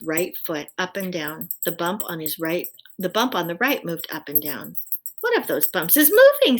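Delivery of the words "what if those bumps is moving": high, loud worried question; alarmed. A narrator's reading. Owl alarmed at bumps under his blanket, loud and high. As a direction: let the fear jump up.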